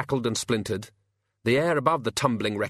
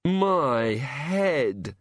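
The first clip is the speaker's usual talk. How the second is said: groaning; 3 semitones higher than usual. A narrator's reading. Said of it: "said the boy".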